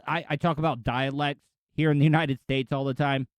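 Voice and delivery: ordinary talk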